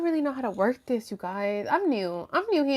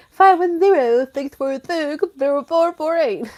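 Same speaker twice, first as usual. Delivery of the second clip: loud, higher put-on announcer voice; mock hotline call-out; 5 semitones higher, 5 dB louder than usual. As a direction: ham up the number for imagined callers. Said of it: "gonna be here all fucking night being like".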